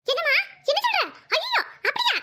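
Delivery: high-pitched disbelief; stunned by bad news on the phone; shocked. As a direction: gasp out the questions, voice leaping up.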